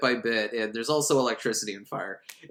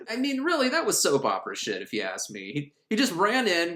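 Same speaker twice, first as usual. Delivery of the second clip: normal talking voice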